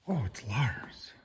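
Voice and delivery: slow, whispered discovery; surprised whisper. Acted. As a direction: whisper delightedly as you spot him. Whispering on seeing the other dog.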